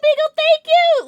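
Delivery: high, loud squeal of thanks; excited squeal. Real, not acted. Streamer thanking a viewer for a gift, excited squeal. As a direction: burst out thanking them, way up high.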